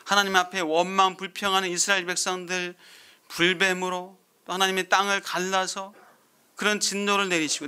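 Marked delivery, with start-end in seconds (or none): none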